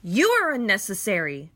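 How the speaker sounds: loud, high yell. Acted. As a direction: hurl it back at him full force. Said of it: "I yelled".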